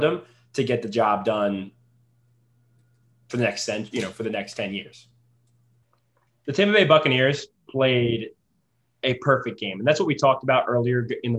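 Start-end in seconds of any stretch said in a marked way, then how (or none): none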